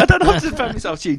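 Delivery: high, fast, laughing while protesting; breathless giggly protest; flustered laughing. Retold or imitated. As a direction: rush it out through the giggles.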